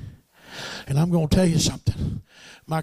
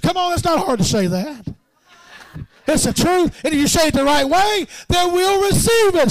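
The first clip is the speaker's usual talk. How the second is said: high, loud preacher's shout building; full-force preaching; 11 semitones higher, 6 dB louder than usual. Acted. Preacher rousing the saints, loud and high. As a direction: preach it out with rising fire.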